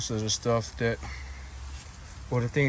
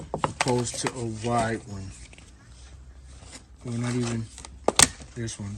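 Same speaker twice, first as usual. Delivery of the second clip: normal talking voice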